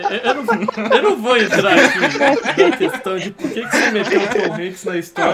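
high voice breaking up with laughter; laughing teasing. Real, not acted. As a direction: tease a friend through real laughter. Teases friend while cracking up. (zoando entre risadas)

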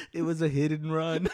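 laughing, pitch up; chuckling through the line; amused and cracking up. Real, not acted. Laughing at his own story.

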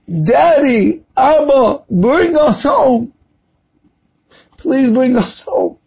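earnest tearful prayer; heartfelt prayer. Real, not acted. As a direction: plead with God to bring them home. Prayer pleading to God.

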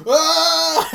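high loud short scream; kid's scream. Retold or imitated. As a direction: scream as the child sees it. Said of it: "you hear".

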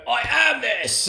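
high, loud, slow booming chant; mimicking Tony Robbins. Imitating Tony Robbins pacing the stage, booming. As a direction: pound out the affirmation like a stage guru. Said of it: "bang his chest".

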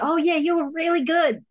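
high pleasantly surprised "oh yeah"; suddenly remembering he was good; surprised realization. Retold or imitated. Acted reaction quote.

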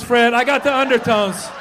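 ordinary talk

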